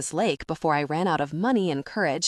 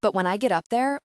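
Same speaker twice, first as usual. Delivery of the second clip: ordinary delivery, nothing marked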